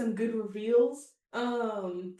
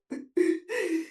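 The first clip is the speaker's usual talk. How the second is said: higher-pitched burst of laughter; real laugh; 7 semitones higher than usual. Real, not acted. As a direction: crack up laughing. Breaks up laughing mid-discussion.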